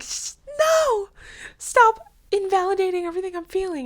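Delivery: high, loud indignant cry; angry protest. Retold or imitated. Teen snapping at auntie's answer, high angry cry. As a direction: shout at her, offended and hurt. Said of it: "made me so mad".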